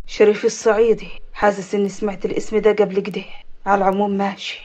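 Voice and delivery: low man's voice, musing; deep, guarded thinking aloud; brooding avenger. Acted.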